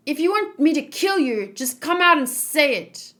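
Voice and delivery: loud, high and furious; hammed-up furious threat to a traitor. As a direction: dare him to fight, shouting. Eren threatens Reiner.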